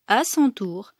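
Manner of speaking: plain reading voice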